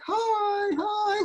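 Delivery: imitating a trainer's high coaxing hello; high sweet greeting. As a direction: greet the snarling dog in a tiny high voice. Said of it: "with this high voice".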